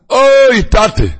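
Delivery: high, loud drawn-out "oi" falling away; imitated pleading shout; crying out. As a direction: cry out to Father in a long wail. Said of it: "in Schreien".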